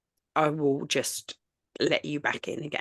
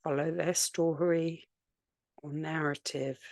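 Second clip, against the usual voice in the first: unhurried meditation voice with long pauses between phrases; guided meditation; 3 dB quieter than usual. Real, not acted. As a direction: gently guide listeners to settle, leaving space after each phrase.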